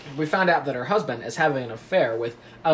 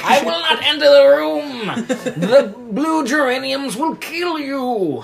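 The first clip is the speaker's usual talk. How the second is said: fortune teller; 11 semitones higher, 4 dB louder, slower than usual. Acted. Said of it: "and she's like".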